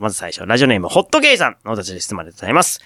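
ordinary delivery

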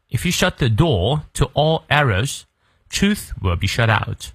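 slow British English, r dropped in "door"; British model reading, no r after the vowel in "door"; British pronunciation. Shown as a demonstration. Teacher showing the British version to learners, non-rhotic British accent. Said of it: "如果是英式发音呢， 元音后的而不念".

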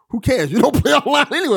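loud, fast, mocking dismissal; dismissive retort. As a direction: toss it off like it's obvious. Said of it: "Dev's like".

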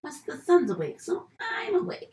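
high, soft imitation of a wakeful baby; lightly voiced baby. Mom voicing her newborn announcing he is awake, playful high voice. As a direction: lightly play the baby, pitch lifting toward the end. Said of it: "now he's like".

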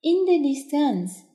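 plain reading voice

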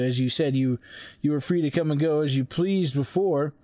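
ordinary delivery

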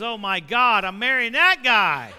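loud, slow, laughing bride's horror; loud mock dismay. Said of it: "your wife-to-be goes".

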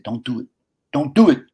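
calling out a warning from the crowd; retold warning shout.

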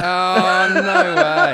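delighted and amazed; laughing disbelief. Real, not acted. Podcast host reacting to Greg story, high laughing delight.

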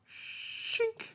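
whispered high shink; high sound effect. Pretend. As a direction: voice a blade snapping in. Toy clicking in sound.

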